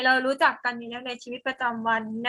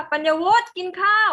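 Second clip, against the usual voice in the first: raised, higher-pitched calling voice; mother's shouted call; 6 semitones higher, 6 dB louder than usual. Shown as a demonstration. Teacher shows a mother calling her child to eat, shouting. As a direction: call the child to dinner loudly, as a demonstration. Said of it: "แบบตะโกนมา".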